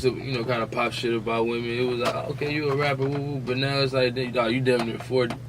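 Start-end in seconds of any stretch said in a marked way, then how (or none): none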